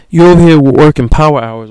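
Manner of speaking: loud excited hype. Real, not acted. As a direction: gas the listener up. Hyping up the emailer.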